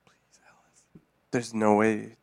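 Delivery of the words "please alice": whispering. Real, not acted. Hushed plea while the call dials.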